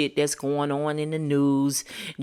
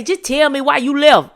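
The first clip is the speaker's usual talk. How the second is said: high, loud mocking plea; mocking people who demand why; mock pleading; 9 semitones higher, 8 dB louder than usual. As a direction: mimic someone begging for answers. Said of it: "I hate when people say".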